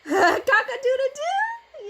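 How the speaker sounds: high, slow laughing cock-a-doodle-doo; laughing rooster crow. Pretend. Mom crowing for her toddler, high and laughing. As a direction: crow it like a rooster through giggles.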